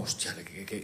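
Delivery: shocked hush. Retold or imitated. Father stunned after shouting at his kids, hushed dismay. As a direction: let it die in your throat as you realise. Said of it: "me quedo como".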